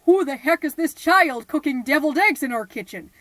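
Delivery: loud, indignant demand; furious husband yelling at his wife; angry outburst. Acted. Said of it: "and he goes".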